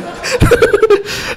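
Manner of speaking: a squeaky high embarrassed laugh. Real, not acted. Vlogger laughing at his awkward answer, high embarrassed laugh.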